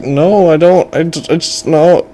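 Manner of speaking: high, stammering refusal; flustered boy. Acted. Boy cannot pay fortune teller, high flustered stammer. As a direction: stumble over it like a distressed kid. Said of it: "He's like".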